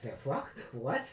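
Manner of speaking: quiet, bewildered reaction; baffled muttering. Acted. Bystander looks back after being jumped over, baffled mutter. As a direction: mutter it under your breath, confused.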